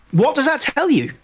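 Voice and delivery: ordinary talk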